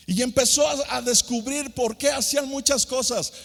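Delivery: a preacher lifting the crowd; fervent preaching. Acted. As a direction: preach it out with full fervor. Preaching at full force.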